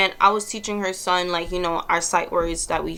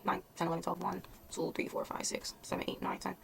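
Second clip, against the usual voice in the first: very soft, half-whispered counting to oneself; counting under breath; 14 dB quieter than usual. Real, not acted. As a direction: count quietly to yourself, nearly whispering. Doing the math to herself.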